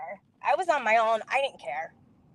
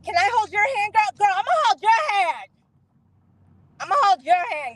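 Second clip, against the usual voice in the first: pushy mock insistence; 7 semitones higher than usual. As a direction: press her in a high, goading voice. Teasing the woman before prayer.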